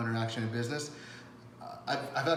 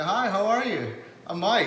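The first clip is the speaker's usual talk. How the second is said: a bright, higher, louder hello; friendly greeting; 8 semitones higher, 7 dB louder, slower than usual. Pretend. Mike acting out meeting someone, a higher, louder, upbeat hello. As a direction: greet them like a new friend. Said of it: "You say".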